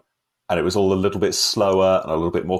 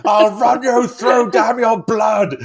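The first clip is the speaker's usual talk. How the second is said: high, loud, slow hammed-up anguished yell; mock screaming; 13 semitones higher, 6 dB louder, much slower than usual. Mocking the film's screaming actors, slow overwrought shouting. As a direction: ham up a tormented mutineer's scream. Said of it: "All of them just scream".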